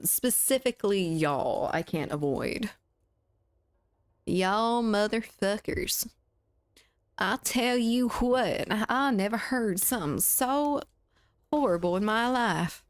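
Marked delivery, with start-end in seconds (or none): 4.2-6.1 slow Southern drawl on y'all; Southern accent. Shown as a demonstration. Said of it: "Southern accent really comes out". 7.2-12.8 higher, drawn-out Southern indignation; Southern accent. Acted. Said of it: "my Southern accent comes out".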